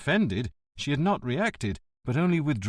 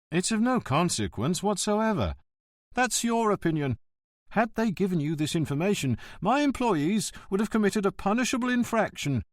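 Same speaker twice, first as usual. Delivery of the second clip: high, prissy voice of a self-important official; a petty bureaucrat standing on his dignity; pompous postmaster; 8 semitones higher than usual. Acted. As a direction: lecture him from behind the counter.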